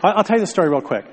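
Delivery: talking fast. Real, not acted. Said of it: "I'm gonna talk like an auctioneer".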